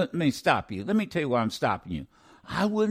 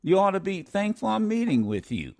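higher, mock condescending tone; 6 semitones higher than usual. Imitating officials talking down to the family, condescending. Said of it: "in a condescending way".

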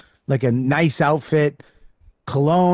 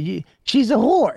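raised-pitch mock insult; imitating Keith; 8 semitones higher than usual. Friend mocks a woman to her face, high mock voice. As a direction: blurt it like blunt Keith, higher. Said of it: "right in her face".

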